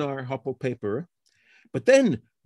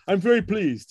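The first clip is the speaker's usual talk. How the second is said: higher-pitched, lightly imitated German accent; Gerd Smolka's German accent; 5 semitones higher than usual. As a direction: quote a colleague with a hint of German accent. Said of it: "very German way of speaking".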